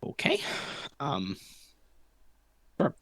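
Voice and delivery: ordinary talk, nothing marked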